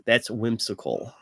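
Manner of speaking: ordinary delivery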